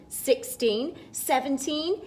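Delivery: ordinary delivery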